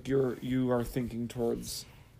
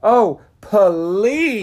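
loud, high, drawn-out scoff; exaggerated disbelief; 5 semitones higher, 12 dB louder than usual. Real, not acted. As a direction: call out the obvious lie, loudly. Mock quarrel over dating denial.

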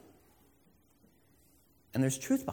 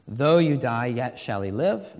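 emphatic scripture quote; 9 dB louder, slower than usual. Acted. Quoting Jesus emphatically.

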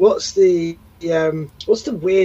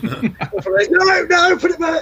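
much higher, laughing mock warning; mock scolding; 14 semitones higher, 3 dB louder, much slower than usual. Host echoes the guitarist warning a child off his guitar, high and laughing. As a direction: squeak a panicky warning through a laugh.

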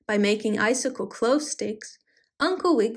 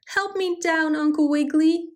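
bleating plea; 6 semitones higher than usual. A narrator's reading. Stranded goat asks Uncle Wiggily for help, bleating. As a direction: call out higher, pleading like a goat. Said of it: "he bleated".

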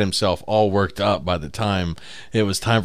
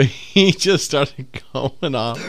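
ordinary talk, nothing marked

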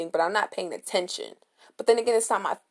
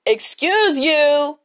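slow, drawn-out sassy retort; sassy excuse you; 6 semitones higher, 7 dB louder, much slower than usual. Retold or imitated. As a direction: stretch it out to put her in her place. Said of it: "you're like".

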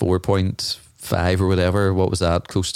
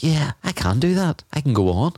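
mock self-boost; 8 semitones higher than usual. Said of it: "I was like".

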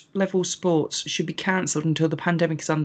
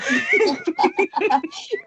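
high laugh. Real, not acted. Laughing at the question.

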